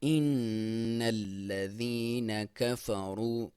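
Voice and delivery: إِنَّ nasal hum held long; slow recitation with ghunna. Shown as a demonstration. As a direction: hum the noon through the nose. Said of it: "یہاں پہ غنہ کیا جاتا ہے".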